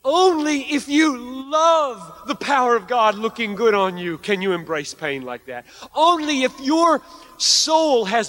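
high, loud, impassioned sermon climax; fervent preaching. Acted. Preacher urging hearers to embrace pain for God, fervent full-force preaching.

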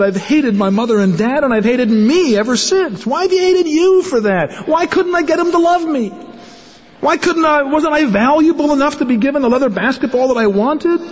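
high, pained outburst; voicing a hurt man's bitter questions, pitched high; raw, pleading complaint; anguished cry. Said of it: "He said".

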